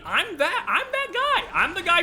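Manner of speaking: high, insistent squeal of admission; emphatic self-identification. Real, not acted. Streamer owning up to being that guy, high-pitched and emphatic. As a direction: jump way up in pitch, protesting.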